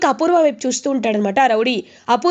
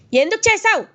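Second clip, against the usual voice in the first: much higher, loud repeated demand; 9 semitones higher than usual. Retold or imitated. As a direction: explode on the second asking. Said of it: "అని గట్టిగా అడుగుతాడు గగన్".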